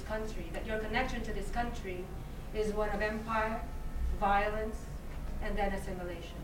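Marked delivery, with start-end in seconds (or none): none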